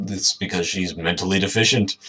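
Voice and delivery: ordinary talk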